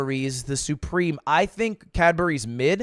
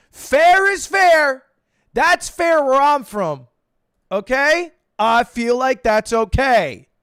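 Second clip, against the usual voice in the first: emphatic; 6 semitones higher, 6 dB louder, slower than usual. Real, not acted. Defending petty ranking, emphatic.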